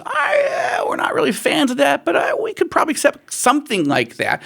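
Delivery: high, reluctant voice of Israel; a hammed-up, high grudging okay; grudging acceptance. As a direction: play it as a half-willing yes, pitched high. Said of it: "Israel t-, says".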